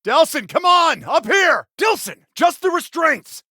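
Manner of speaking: high, loud yell for help; urgent calling out. Acted. Brother calling Delsin up to free his restraints, urgent shouting. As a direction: shout up to him, pressing him to hurry.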